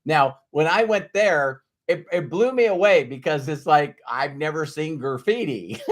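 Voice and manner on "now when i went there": ordinary talk, nothing marked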